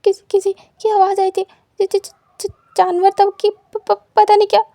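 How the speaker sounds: panicked, stuttering, loud and high; terrified stammer. Acted. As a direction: gasp it out after running from a noise. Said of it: "wing दौड़ता हुआ आता है". (डर से हकलाते हुए ज़ोर से)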